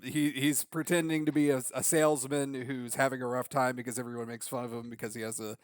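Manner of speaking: ordinary talk, nothing marked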